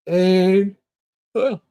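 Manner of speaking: talking through a yawn. Real, not acted. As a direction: yawn mid-sentence. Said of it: "I'm bloody yawning".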